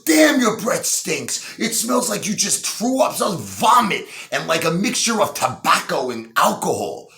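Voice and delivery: gag through it in a high pitch; disgusted high voice. Acted. Client recoiling from the escort's stinking breath.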